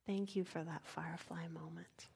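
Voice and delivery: soft reverent whisper; whispered thanks. Real, not acted. Speaker praying in thanks for the moment, whispered. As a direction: breathe the thanks like a prayer.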